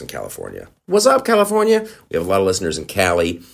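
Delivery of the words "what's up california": high, loud shout-out to the fans; excited greeting. Real, not acted. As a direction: call out like a radio host. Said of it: "greeting listeners".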